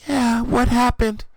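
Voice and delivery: very loud, high cartoon yell; cartoonish bellowed question; mock shout. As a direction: bellow it in a hammed-up crowd voice. Darkness voiced yelling.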